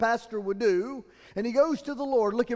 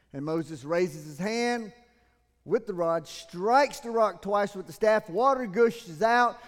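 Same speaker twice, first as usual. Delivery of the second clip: ordinary talk, nothing marked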